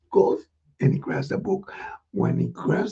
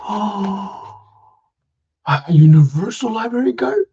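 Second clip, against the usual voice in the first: high louder rising question; astonished; 5 semitones higher, 5 dB louder than usual. Acted. Ricky astonished at the card from Santa. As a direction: repeat it in disbelief.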